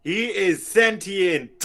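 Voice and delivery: slow, loud, high insistence; insistent shout. Real, not acted. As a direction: declare it firmly, drawn out. Insisting object is sentient.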